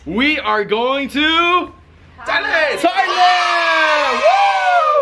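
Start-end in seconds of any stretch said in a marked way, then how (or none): 2.2-5.0 loud, thrilled shouting of the destination; excited shout. Real, not acted. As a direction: cheer it out, pumped up. Vlogger hyping the trip start.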